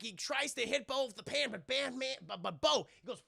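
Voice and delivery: ordinary talk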